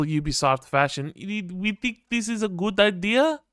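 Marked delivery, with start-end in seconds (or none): 1.2-3.4 high, put-on French accent, mocking a CEO; French-accented mock CEO, pitched way up; Yves Guillemot impression. Streamer mocks Ubisoft's CEO with a high French accent. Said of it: "my best Yves Guillemot impression".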